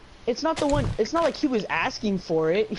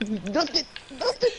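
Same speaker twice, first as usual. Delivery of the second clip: shaky reply; 5 semitones higher than usual. Retold or imitated. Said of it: "I was shaking".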